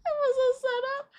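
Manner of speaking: high-pitched, helpless laughter through the words; laughing hard. Real, not acted. Friend teasing about a setup, cracking up laughing. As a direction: crack up while saying it.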